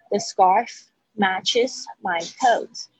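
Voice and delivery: slow, careful "Matches" with clear ending; slow clear reading. Shown as a demonstration. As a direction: read it slowly, word by word. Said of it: "注意一下matches". (慢慢清楚地念)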